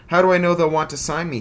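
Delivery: high, loud, fast greedy eagerness; eager. Acted. Bully hooked by Bug's offer, high fast eager rush. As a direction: gush like he's almost drooling. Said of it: "almost drooling".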